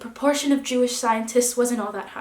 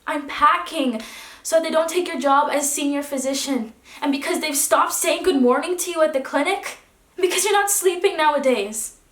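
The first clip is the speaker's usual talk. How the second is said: louder, higher, rising agitation; agitated outburst; 4 semitones higher, 6 dB louder than usual. Acted. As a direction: build insistently through each reason. Wife's upset outburst to husband.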